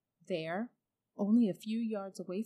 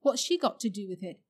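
Diggory defensive; 7 dB louder than usual. A narrator's reading. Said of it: "said Diggory".

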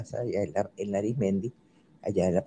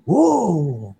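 a short mock yell, higher and louder; retold startling yell; 6 semitones higher, 6 dB louder than usual. Schoolgirl startling the old barbers with a yell. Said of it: "pega un grito".

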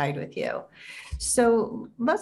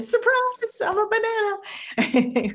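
much higher, slower playful reveal; mock surprise reveal; 3 dB louder, slower than usual. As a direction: jokingly squeal it out. Mock reveal punchline of joke.

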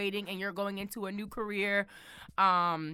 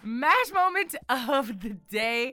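segment announcer; 3 semitones higher, 3 dB louder, slower than usual. Acted. Announcing her recurring segment title.

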